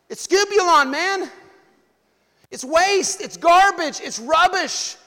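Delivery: loud, high, driving preacher's climax; full-force preaching. Acted. Pastor drives the point home to the church, preaching at full force. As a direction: hammer each word home to the congregation.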